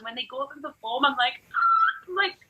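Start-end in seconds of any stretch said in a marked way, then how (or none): 1.5-2.1 very high, loud squeal of delight; proud squeal. Pretend. Teacher watches her students perform, very high proud squeal.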